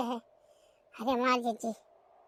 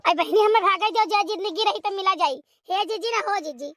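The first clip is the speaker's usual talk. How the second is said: ordinary talk, nothing marked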